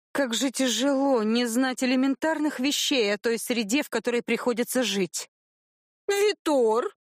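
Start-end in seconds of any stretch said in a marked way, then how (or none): 6.1-6.9 high, sing-song calling of a name; theatrical girl's call. A narrator's reading. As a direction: call him out playfully, pitched up. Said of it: "театрально надула губки".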